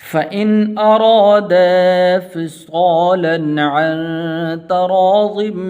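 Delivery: full tajweed recitation with long held vowels; Quran recitation. Acted. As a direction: recite the whole passage as a flowing chant. Full recitation after the lesson.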